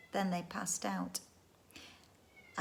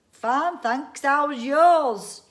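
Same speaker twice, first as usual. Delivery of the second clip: slow, louder odd voice from the hills; strange voice answering; 4 semitones higher, 11 dB louder, much slower than usual. Acted. Hidden creature answering Andy's cheeky question. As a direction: answer slowly in an otherworldly voice. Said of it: "came the answer".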